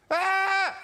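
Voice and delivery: high puppet cry from far off; muffled puppet cry. Acted. Hidden puppet answering the children's call, high cry.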